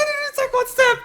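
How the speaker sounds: very high, cracking exclamation; shrill outraged cry; high disbelieving shout. Real, not acted. Enemy didn't move, outrage.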